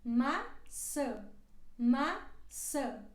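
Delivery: maçã with the first a open and the final ã nasal; careful model word. Shown as a demonstration. Teacher models maçã, open a then nasal ã. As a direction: say maçã clearly for learners to repeat. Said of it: "uma A mais aberta e a outra mais fechada".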